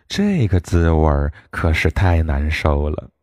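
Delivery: plain reading voice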